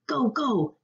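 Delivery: high, urgent mock yell. A narrator's reading. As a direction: cheer them on without much volume. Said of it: "yelled Binky and Naya".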